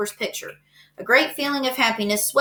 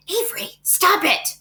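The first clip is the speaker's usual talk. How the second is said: scolding mother; 9 semitones higher than usual. A narrator's reading. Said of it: "cried his mother".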